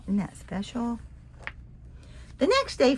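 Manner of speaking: usual speaking voice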